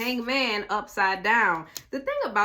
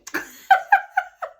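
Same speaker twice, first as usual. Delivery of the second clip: loud, high cackling laugh; real high-pitched crack-up; 11 dB louder than usual. Real, not acted. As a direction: burst out laughing at yourself. Laughs at own excitement.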